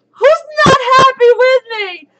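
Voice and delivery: high, loud squeal; piercing celebratory cry; elated shout. Real, not acted. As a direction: squeal it out to the whole crowd. Celebrating the announced trip.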